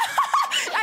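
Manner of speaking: very high burst of mock-delighted laughter; short shrieky laugh, hammed up; squealed giggle. Acts out coy delight.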